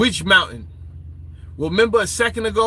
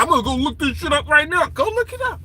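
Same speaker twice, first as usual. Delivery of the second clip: higher, quick voice of an indignant sceptic; mock doubting listener; 4 semitones higher, faster than usual. Mimicking listeners who went to look it up, high hurried mockery. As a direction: climb higher as you rush to check. Acts listeners rushing to check.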